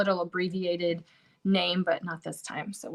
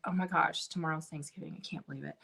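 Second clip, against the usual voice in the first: "tomorrow's Thanksgiving" said much softer and lower, an awed hush; stunned disbelief; 5 semitones lower, 7 dB quieter than usual. Retold or imitated. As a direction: let it sink in, quiet and amazed. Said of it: "and now I'm like".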